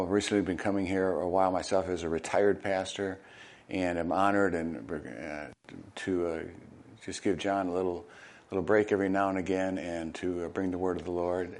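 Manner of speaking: normal talking voice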